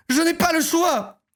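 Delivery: high, slow put-on Marseille accent. Streamer re-voicing the sister, Marseille accent. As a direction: stretch it out in a sing-song Marseille accent. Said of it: "Évidemment qu'elle est de Marseille".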